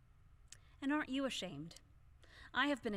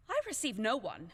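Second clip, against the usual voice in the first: indignant; 7 dB louder than usual. Acted. Widow insisting to the servant.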